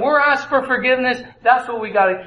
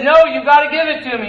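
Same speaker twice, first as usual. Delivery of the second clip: louder, higher demand; angry insistence; 5 semitones higher, 5 dB louder, faster than usual. Acted. Forgiven servant shouting at his debtor to pay, louder. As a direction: force him to pay up.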